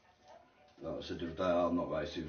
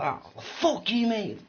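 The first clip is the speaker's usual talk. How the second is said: mock angry retort; 4 dB louder, slower than usual. Retold heated row with Lewis.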